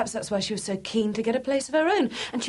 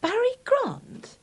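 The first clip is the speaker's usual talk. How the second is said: astonished; 8 semitones higher, slower than usual. Acted. Astonished at news.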